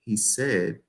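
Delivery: very soft, low, confidential; whispering to best friend. Shown as a demonstration. Coach shows a storyteller confiding in a best friend, hushed. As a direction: let them in on a secret, hushed. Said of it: "like you're whispering to your best friend".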